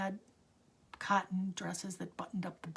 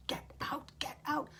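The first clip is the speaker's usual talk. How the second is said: retold shouting; 8 semitones higher than usual. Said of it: "he's banging".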